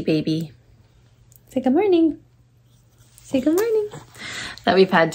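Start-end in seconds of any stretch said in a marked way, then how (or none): 1.5-2.2 put-on high baby-talk voice. Mother prompts baby greeting. 3.2-3.9 even higher sing-song prompt; baby talk. Acted. Repeated baby greeting, higher.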